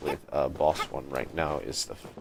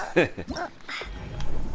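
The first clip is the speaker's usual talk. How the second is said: high breathy giggle; airy giggle. Real, not acted. Gamer giggling after a good play, airy giggle. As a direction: giggle lightly.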